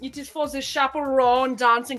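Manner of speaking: comic German accent, put on; hammed-up German English. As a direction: present the outfit in a mock German accent. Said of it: "I did drop the German".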